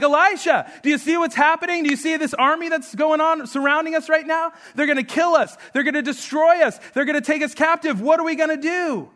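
freaking out, high-pitched and urgent; panicked servant. Retold or imitated. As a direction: act out a servant's rising alarm. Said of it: "servant just starts freaking out".

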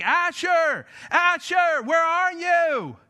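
high, drawn-out calling out; yelling for someone. Retold or imitated. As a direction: call for a lost child across a mall. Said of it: "And I'm yelling".

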